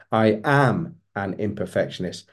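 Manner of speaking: firm, insistent weight on the word "am"; stress on AM. Shown as a demonstration. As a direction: hit "am" hard, let the rest fall. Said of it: "emphasize each word differently as you say it".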